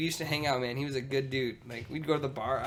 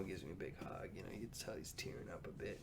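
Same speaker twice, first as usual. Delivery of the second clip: whispering, nearly no voice; hushed; 5 semitones lower, 18 dB quieter than usual. Real, not acted. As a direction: drop to a breathy hush. Tender memory of dad.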